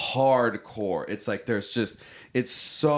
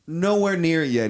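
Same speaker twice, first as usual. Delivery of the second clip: normal talking voice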